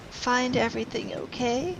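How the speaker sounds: high little gnome lady voice; gnomish lady. Acted. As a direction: chirp it like a tiny shopkeeper. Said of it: "the gnomish lady says".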